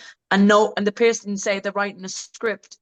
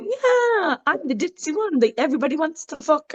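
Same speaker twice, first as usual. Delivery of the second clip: much higher airhead voice; ditzy fake accent; 7 semitones higher than usual. Mocking a performer's put-on ditzy accent, high. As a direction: play the fake ditzy girl. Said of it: "too, her real accent".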